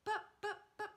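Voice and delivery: high, halting stammer; a stammering, flustered mother. Acted. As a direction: stammer it out in shock. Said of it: "stammered George's mother".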